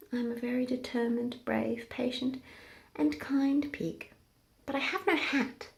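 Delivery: soft, gentle little pig; soft, content pig. Acted. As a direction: say it softly and contented. Pig speaks softly.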